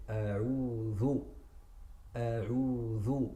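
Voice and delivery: careful "A'udhu" with clear ayn and dhal; correct recitation. Shown as a demonstration. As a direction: recite "A'udhu" slowly, every letter voiced. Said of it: "So you're gonna say".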